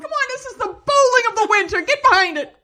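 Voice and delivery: very high, loud rallying shout; hammed-up fan yell. Fan urging others to support curling, rousing yell. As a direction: holler it like a fanatic.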